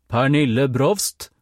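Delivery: slow, loud call of the name. A narrator's reading. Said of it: "Enda høyere". (roper navnet enda høyere, langsomt)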